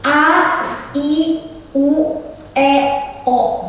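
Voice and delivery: A, I, U, E, O each separated and wide open; lion-face vowel drill. Shown as a demonstration. Public speaking trainer shows articulation practice, wide separated vowels. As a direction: open the mouth huge on every vowel. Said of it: "buka A segede-gedenya".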